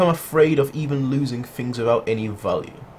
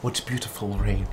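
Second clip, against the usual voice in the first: mock dreamy remark; 2 semitones lower, 6 dB quieter than usual. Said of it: "just be like".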